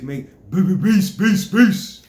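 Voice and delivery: high, loud beep beats; beat sounds. Shown as a demonstration. Demonstrating vibration to boys, loud beat sounds. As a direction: pump out beats like music. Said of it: "make a vibration".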